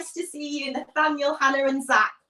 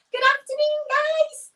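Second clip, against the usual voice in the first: much higher, squeaky hello; high greeting; 14 semitones higher, 3 dB louder than usual. Real, not acted. Children's church leader greeting viewers, silly high voice.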